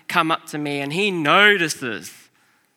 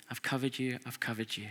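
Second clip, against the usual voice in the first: softer each time, fading; hushed loving promise; tender reassurance; 3 semitones lower, 12 dB quieter, faster than usual. Retold or imitated. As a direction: let it settle gently. God's voice reassuring him.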